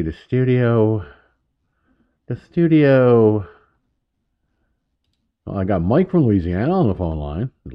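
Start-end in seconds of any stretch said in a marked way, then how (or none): 0.0-1.1 announcer voice. Pretend. Announcing his studio, drawn out. 2.3-3.5 announcer voice. Pretend. Announcing his studio, drawn out.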